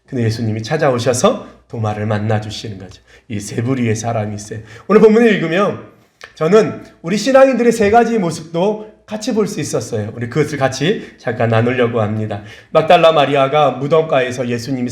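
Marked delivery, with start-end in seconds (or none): none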